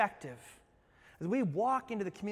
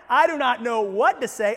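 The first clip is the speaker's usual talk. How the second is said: 1 panicked blurt; 4 semitones higher, 12 dB louder than usual. Pretend. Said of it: "You are freaking out".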